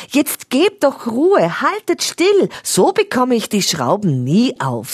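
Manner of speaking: put-on boy struggling with stuck screws, annoyed; upset scolding. A narrator's reading. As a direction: snap at fidgety toys. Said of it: "sagt Stefan aufgebracht".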